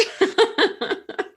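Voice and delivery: high, giddy squeal of a laugh. Real, not acted. Fan laughing giddily with a friend, high squeal. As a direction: laugh like a delighted fangirl.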